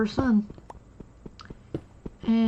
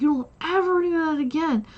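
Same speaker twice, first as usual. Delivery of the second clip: stern scolding; 6 semitones higher, faster than usual. Retold or imitated. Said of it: "I was like".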